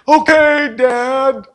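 put-on high, loud grudging child's "okay"; sulky child giving in. Pastor playing a grumbling child to God, high and loud. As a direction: give in like a pouting kid to his father. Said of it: "I finally say".

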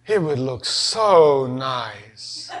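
slow, soft wistful hint; drawn-out longing suggestion; dreamy wife. Retold or imitated. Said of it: "but she was like".

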